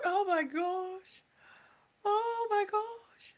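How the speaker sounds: slow, drawn-out, near tears; rising into a high, whispery oh; fighting tears. Real, not acted. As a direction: overcome and welling up. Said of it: "I'm not crying".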